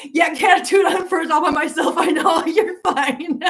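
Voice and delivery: high and laughing, teasing a viewer; playful laughing reply to a viewer; laughing tease. Real, not acted. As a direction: giggle through the reassurance.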